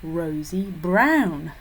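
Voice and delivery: ROsy lighter, then a strong BROWN; stress on BROWN. Shown as a demonstration. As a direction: let BROWN dominate "Rosy, brown". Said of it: "c'est le mot de qui va dominer".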